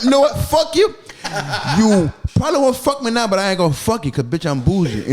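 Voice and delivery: loud, high mock rant; loud mock inner rant; inner voice yelling. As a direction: let the secret trash talk explode, hammed-up. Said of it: "But in my head, I'm like".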